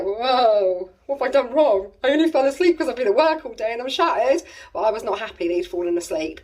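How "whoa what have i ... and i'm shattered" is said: lightly voiced husband, raised and defensive. Husband woken on the sofa, defensive protest. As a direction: protest your innocence, bewildered. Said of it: "He was like".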